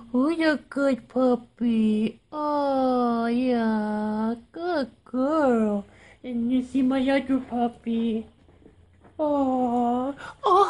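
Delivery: high, sing-song puppy talk; talking to a dog. Real, not acted. Cooing over her dogs.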